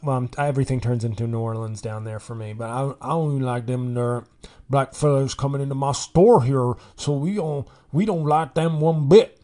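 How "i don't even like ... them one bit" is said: put-on Mississippi accent. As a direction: imitate an indignant Mississippi store owner's accent. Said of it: "some guy from Mississippi was like".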